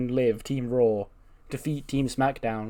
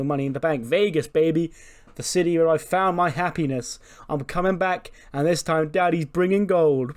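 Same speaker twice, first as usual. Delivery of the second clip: loud, cocky, higher boasting; brash Corbin brag; 4 semitones higher, 5 dB louder than usual. Retold or imitated. Corbin telling Kayla he's bringing gold from Vegas, loud and cocky. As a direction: swagger through it like a loud braggart. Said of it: "He's like".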